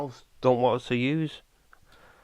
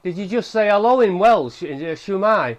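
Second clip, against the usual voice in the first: excited surprise; 8 semitones higher, 10 dB louder than usual. Real, not acted. Thinks a spirit answered.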